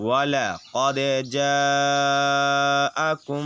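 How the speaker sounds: slow chanted tajweed reading; slow recited verse; Quran recitation. Shown as a demonstration. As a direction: draw out جاءكم slowly like in prayer.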